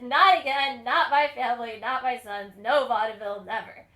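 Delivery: loud, emphatic Eastern European father forbidding it; firm refusal. Retold or imitated. Joshua loudly forbidding his Sons the vaudeville stage. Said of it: "He said".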